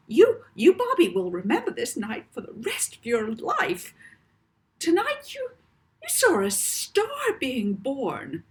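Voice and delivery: a starstruck mother swooning over the show; mother gushing to her son Bobby, swooning and high; gushing smitten mother. Acted. Smitten mother gushes about the show.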